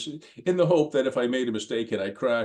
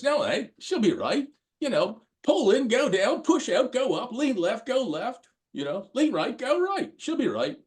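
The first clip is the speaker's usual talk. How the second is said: put-on broad Australian accent, much higher and carefree; imitating a breezy Australian bloke, pitched way up; 12 semitones higher than usual. A young man brushing off a warning, in a high, breezy Australian accent. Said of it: "that Australian accent of his".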